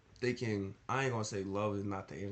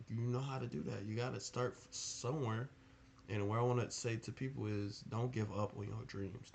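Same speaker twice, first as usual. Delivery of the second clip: ordinary talk, nothing marked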